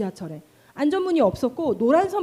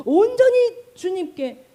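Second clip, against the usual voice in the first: 온전히 stretched slow, high and loud; emphatic stress; 5 semitones higher, 6 dB louder, slower than usual. Real, not acted. Preacher urging the congregation, emphatic and slow. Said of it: "preacher stressing the key phrase".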